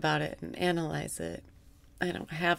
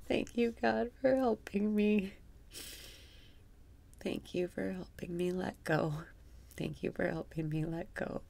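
guided meditation; 3 semitones lower, 5 dB quieter than usual. Acted. Guiding listeners through breathwork in a soft meditation voice. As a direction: guide the breath calmly, half amused.

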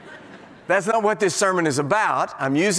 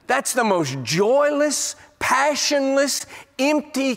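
slow, rising, punched adjectives; preacher hammers joyless faith to the church, slowly building; emphatic list; 7 semitones higher, slower than usual. Real, not acted.

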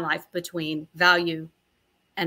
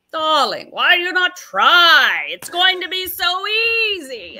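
imitating friend Mira; 9 semitones higher, 8 dB louder than usual. Friend Mira urging her to go for Globalist, mock diva voice. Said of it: "that was an excellent imitation".

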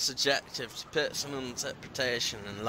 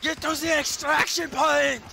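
high shouted order; 12 semitones higher than usual. Pretend. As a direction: shout it high, like a panicked soldier. Reworks the quote, yells higher.

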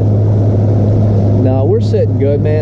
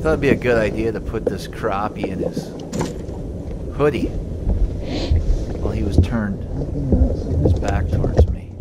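soft sneaky whisper; confiding a prank to the camera in a soft whisper; 7 semitones lower, 8 dB quieter than usual. Real, not acted. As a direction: whisper it so Steve won't hear.

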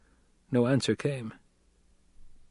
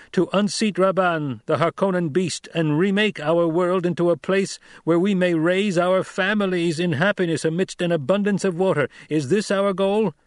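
higher, louder, commanding orator; young duke addressing tribe; 8 semitones higher, 4 dB louder than usual. A narrator's reading. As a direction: challenge the crowd with rising authority. Paul addresses the throng.

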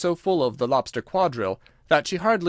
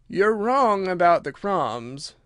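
slow, drawn-out creature, higher pitch; Mock Turtle, ponderous; 6 semitones higher, slower than usual. Mock Turtle corrects Alice, slow and ponderous.

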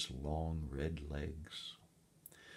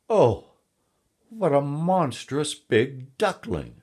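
high, loud, drawn-out astonishment; loud storybook horror at the sight; shocked exclamation; 10 semitones higher, 10 dB louder, slower than usual. A narrator's reading. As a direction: exclaim as an aghast mother duck, slowly. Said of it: "exclaimed his mother".

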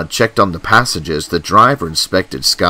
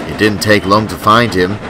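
usual speaking voice

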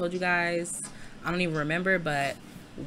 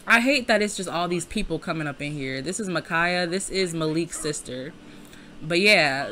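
usual speaking voice

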